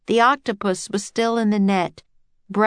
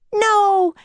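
high, loud yelled protest; girl's yell; 13 semitones higher, 5 dB louder than usual. A narrator's reading. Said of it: "yelled Annie".

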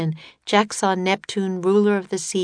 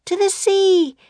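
very high, loud call over the wind; Annie calling out; 14 semitones higher, 6 dB louder than usual. A narrator's reading. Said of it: "Annie called over the wind".

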